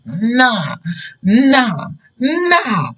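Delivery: escalating, stretched-out denial; indignant refusal. Retold or imitated. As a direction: refuse harder each time, pitch stepping up. Acted refusal to accept the disrespect.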